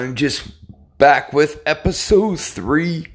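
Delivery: talking through a yawn; stretched out mid-yawn. Real, not acted. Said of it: "I'm yawning a little bit".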